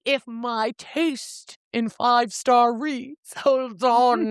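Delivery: high, slowly stretched-out anticipation; playful suspense. Real, not acted. Host before friends rate her favorite, high drawn-out suspense. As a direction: draw it out in a high teasing voice.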